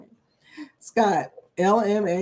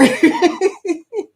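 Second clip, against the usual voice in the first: laughing; 9 semitones higher, 5 dB louder than usual. Real, not acted. Laughing at chat joke.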